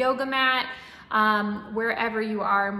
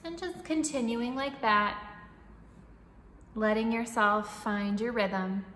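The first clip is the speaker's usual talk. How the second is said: calm, even breathing-coach voice; guided meditation; 8 dB quieter than usual. Acted. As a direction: guide the breath gently and steadily.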